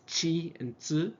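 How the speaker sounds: "chi and tsu" slow and carefully shaped; Japanese syllables. Shown as a demonstration. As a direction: clearly model "chi" and "tsu" slowly. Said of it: "there's no T sound".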